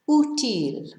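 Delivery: "Útil" as U-til, first syllable stressed. Shown as a demonstration. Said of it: "tells us to stress the first syllable".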